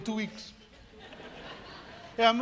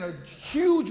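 ordinary delivery

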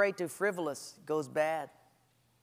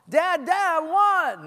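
put-on higher, louder childish whine; whining child; 10 semitones higher, 8 dB louder than usual. Child demanding a thing from dad, whining. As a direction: nag like a demanding kid.

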